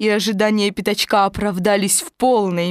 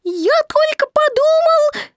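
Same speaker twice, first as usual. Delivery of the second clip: very high little squeak, plaintive; plaintive Piglet; 4 dB louder than usual. A narrator's reading. Piglet defending his idea to Eeyore, plaintive high squeak. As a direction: squeak it out pitifully, high and louder. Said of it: "сказал Пятачок жалобно".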